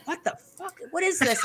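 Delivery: high, hushed disbelief; retold bafflement. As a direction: imitate someone stunned under their breath. Baffled wrestler reacts to disguise.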